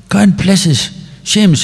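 high, bright Irish brogue; Irish accent. Retold or imitated. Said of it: "the Irish accent".

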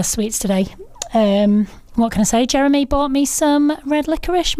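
usual speaking voice

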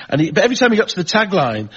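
normal talking voice

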